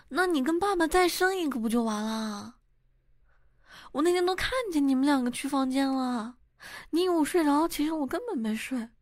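put-on loud high child's yell, blurting it out; child shouting. Son yelling in front of the school gate, loud and high. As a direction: shout it out like a kid with no filter. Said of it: "就大喊一声".

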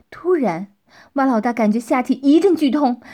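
usual speaking voice

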